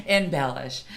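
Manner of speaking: usual speaking voice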